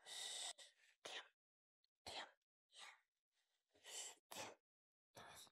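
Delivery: whispered hush. Acted. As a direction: hush the team, barely a breath. Hushing the squad during a bomb hunt.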